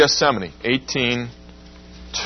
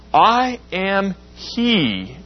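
slow, weighty; 7 semitones higher, much slower than usual. Acted. Jesus' words read slowly.

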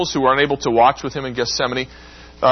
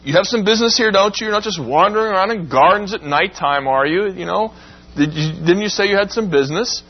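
commanding Jesus, prodding the soldiers to get up; Jesus in charge; 6 semitones higher than usual. Retold or imitated. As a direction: rouse them with a firm, teasing challenge. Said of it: "as if to say".

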